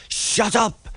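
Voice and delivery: high stern command; a stern rebuke; sharp order. A narrator's reading. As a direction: cut them off firmly. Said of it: "he ordered".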